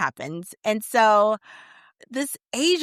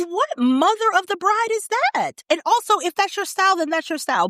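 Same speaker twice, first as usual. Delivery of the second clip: high, incredulous and mocking; 10 semitones higher, faster than usual. Real, not acted. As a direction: raise it high, scoffing at the dresses. Mocking old-fashioned dress options.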